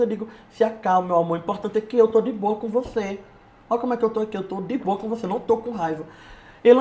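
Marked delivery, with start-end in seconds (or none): 0.5-6.0 calm reassurance. Retold or imitated. Speaker calming an upset friend after his post, soft calm reassurance. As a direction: gently talk a friend down, quietly.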